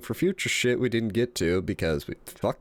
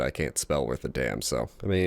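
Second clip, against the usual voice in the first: usual speaking voice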